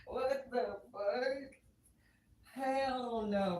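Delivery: high, drawn-out disbelief; high-pitched and slow, at normal volume; baffled refusal. Acted. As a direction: stretch it out in stunned protest.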